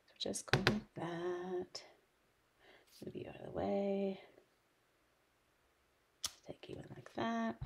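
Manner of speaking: breath held, barely voiced; holding breath. Real, not acted. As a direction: hold your breath while you talk. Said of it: "So I'm holding my breath".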